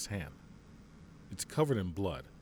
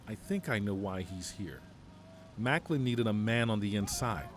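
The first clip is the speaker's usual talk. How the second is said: ordinary talk, nothing marked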